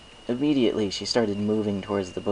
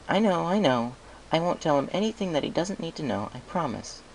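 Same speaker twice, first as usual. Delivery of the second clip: higher, reassuring mare; lightly voiced Twilight promising; Twilight's voice; 8 semitones higher than usual. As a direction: brush off the worry, higher.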